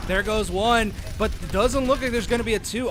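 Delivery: ordinary talk, nothing marked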